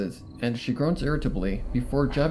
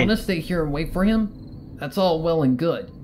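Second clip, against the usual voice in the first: higher, louder cocky pony voice; brash tomboy voice; 5 semitones higher, 8 dB louder than usual. Acted. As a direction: insist like a stubborn friend. Rainbow Dash voiced in story.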